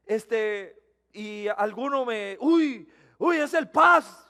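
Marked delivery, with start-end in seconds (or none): 2.4-4.3 high, loud startled cry of recognition; surprised shout. Retold or imitated. Bystander spotting the pastor on the road, startled high shout. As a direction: shout it like you just spotted someone you know.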